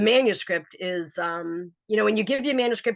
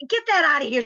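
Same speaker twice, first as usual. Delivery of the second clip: yelled; 7 semitones higher, 6 dB louder than usual. Retold or imitated. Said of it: "And she goes".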